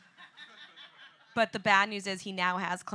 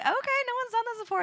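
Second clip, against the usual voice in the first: high excited inner voice; much faster than usual. Retold or imitated. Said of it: "I was like".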